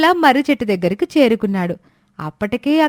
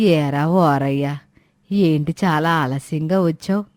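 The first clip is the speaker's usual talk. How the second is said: put-on lower-pitched old woman, complaining; old woman Suramma; 8 semitones lower than usual. Old woman scolding the late milk seller, lower grumbling voice. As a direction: grumble like an impatient old granny.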